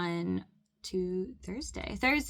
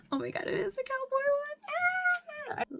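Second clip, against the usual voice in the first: much higher squealing delight; excited squeal. Real, not acted. Fan opening album card, squealing at the cowboy one. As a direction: shriek with fangirl joy.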